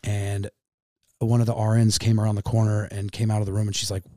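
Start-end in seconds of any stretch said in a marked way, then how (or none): none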